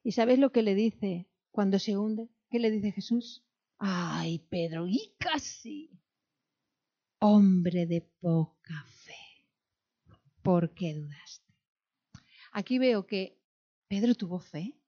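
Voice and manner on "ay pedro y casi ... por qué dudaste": Jesus gently chiding. Acted. Preacher voices Jesus to Peter.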